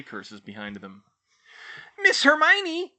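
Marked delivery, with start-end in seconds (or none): 2.0-2.9 very high, loud squeaking elf voice; squeaky elf. A narrator's reading. House elf squeaking to Hermione from her shoulders, high and loud. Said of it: "Dobby squeaked".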